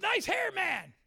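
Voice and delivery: high, loud, shouted praise; blurted compliment. Acted. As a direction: burst out with sudden admiration. Blurts a compliment mid-prophecy.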